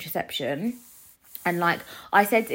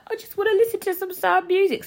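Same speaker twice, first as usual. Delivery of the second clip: high, louder mopey self-quote; mock sad self; 12 semitones higher, 6 dB louder than usual. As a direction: whine it like a moody teen. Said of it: "And then I was like".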